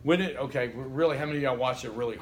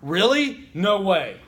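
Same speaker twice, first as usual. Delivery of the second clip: higher, louder, fast, wow really no way; excited interjections; 4 semitones higher, 8 dB louder, much slower than usual. Shown as a demonstration. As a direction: burst out with mock amazement. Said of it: "An interjection is something like".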